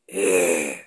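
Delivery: low growl from the vocal cords. Shown as a demonstration. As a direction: growl the eh deep in the throat. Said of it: "I'm growling with my voice".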